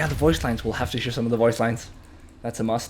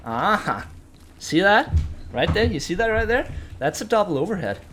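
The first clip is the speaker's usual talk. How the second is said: higher, louder, thrilled pointing-out; excited discovery; 8 semitones higher, 5 dB louder than usual. Real, not acted. Gamer showing viewers a newly found combo, excited discovery. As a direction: call it out like a real find.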